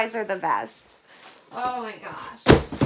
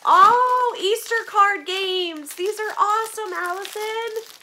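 high, bright burst of joy; light up over the gift; delighted; 8 semitones higher, 4 dB louder than usual. Real, not acted. Thanking Allison for the card games, delighted.

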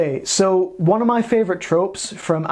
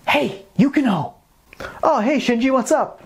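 lightly raised dubbed schoolkid voice; eager teen from an English dub; anime dub voice; 6 semitones higher than usual. Acted.

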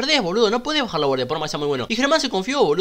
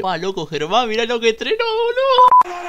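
mock crying; 8 semitones higher, slower than usual. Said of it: "estaba llorando de decir".

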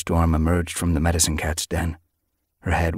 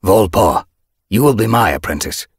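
loud, brisk appointment; 3 semitones higher, 8 dB louder than usual. A narrator's reading. Leader skipping ceremony to appoint apprentice, brisk. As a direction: announce it without any ceremony. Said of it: "Broken Star mewed briskly".

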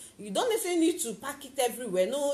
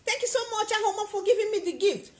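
high, loud whoop of delight, then grateful thanks; delighted squeal; 10 semitones higher, 6 dB louder than usual. Retold or imitated. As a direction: jump with joy at the present. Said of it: "Mary will jump".

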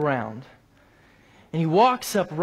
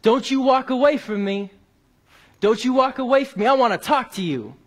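raised, higher-pitched imitated demand; retold yelling; 7 semitones higher, 4 dB louder than usual. Angry man yells after the departing Bible worker, imitated raised voice. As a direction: call it out like an angry man being walked away from. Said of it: "he starts yelling out to me".

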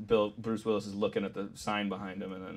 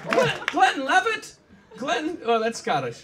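very high, halting comedian parody; hammed-up high voice groping for a bit; high-pitched mock-Irish stand-up; Irish Jerry Seinfeld; 7 dB louder, slower than usual. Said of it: "Irish Jerry Seinfeld".

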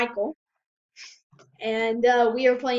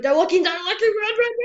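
high, loud warning shout, climbing in pitch; urgent alarm; 8 semitones higher, 7 dB louder, faster than usual. Real, not acted. Player warns teammate they're being watched, urgent rising shout. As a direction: yell for your teammate to run. Sudden alarm to flee in game.

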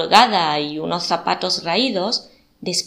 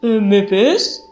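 put-on French accent; 6 dB louder than usual. A narrator's reading. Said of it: "Pese a su marcado acento francés".

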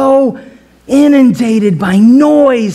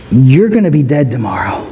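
menacing Jezebel; 8 semitones lower, faster than usual. Retold or imitated. Jezebel threatens Elijah.

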